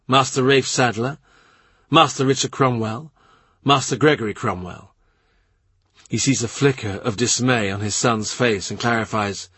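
usual speaking voice

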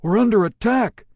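loud, high alarm cry; alarmed shout. A narrator's reading. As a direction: sound the alarm as the hull is hit. Pyle alarmed under fire.

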